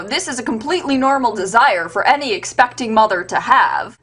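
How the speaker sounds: ordinary talk, nothing marked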